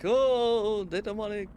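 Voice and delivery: lightly hammed-up high "oh"; mock alarmed people. Streamer mocking people who call toys demonic, mock alarm. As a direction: imitate people fretting, starting high and loud. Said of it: "that's just people being like".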